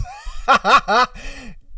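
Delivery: real burst of laughter; laughing. Real, not acted. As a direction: crack up, high and quick. Cracks up at his curse.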